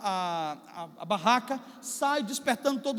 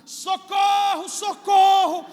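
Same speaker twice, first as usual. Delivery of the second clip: a real full-voiced cry for help, higher and louder; shouting for help; 7 semitones higher, 8 dB louder, much slower than usual. Startled man in his tent at night calls out, loud panicked shouting. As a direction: yell socorro like you're trapped in the tent at night. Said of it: "começa a gritar".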